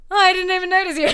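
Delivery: high, loud exaggerated surprise; mock surprise. Teasing Gable about his new haircut, in high loud mock surprise.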